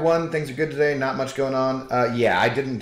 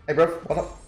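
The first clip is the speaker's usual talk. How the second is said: bad British accent; 6 semitones higher, faster than usual. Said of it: "I can't really do a, a British accent".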